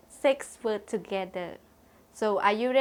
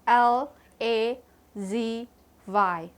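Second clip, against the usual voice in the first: slow letter-by-letter spelling; slower than usual. Shown as a demonstration. Teacher spelling lazy for the students, slow and clear. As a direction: give each letter its own slow beat. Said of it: "Lazy spell".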